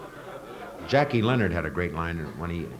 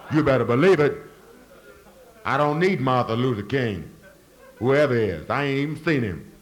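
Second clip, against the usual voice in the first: higher, louder Southern caricature boasting he owns the town; mocking Southern voice; 4 semitones higher, 5 dB louder than usual. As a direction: ham up a boastful Southern local. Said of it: "still say".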